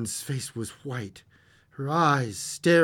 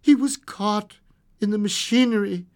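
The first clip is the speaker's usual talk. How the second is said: ordinary delivery, nothing marked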